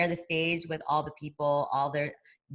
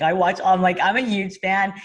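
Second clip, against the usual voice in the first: gushing fan; 3 semitones higher, 8 dB louder than usual. Retold or imitated. Gushing to a boxing legend.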